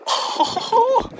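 a sudden high yelp of surprise; short high gasp-word; surprised yelp. Real, not acted. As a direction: yelp as the shell shows up. Another rare shell spotted.

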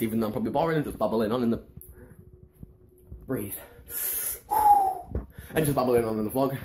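very fast, words tumbling out; rushed babbling. Real, not acted. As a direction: gabble it in one breath. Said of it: "can't even understand what I'm saying".

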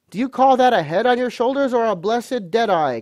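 high, loud berating; crying out angrily. A narrator's reading. Landlord berates Morgan for not knowing, crying out. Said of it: "cried Long John".

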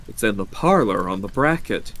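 plain reading voice

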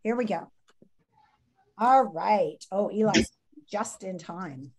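ordinary delivery

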